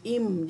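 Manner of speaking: "Im." said alone with a short closed m, as the model; a teacher modelling the second letter "Im."; Tamil letter sound. Shown as a demonstration. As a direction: show how to say "Im.". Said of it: "saya ulang balik".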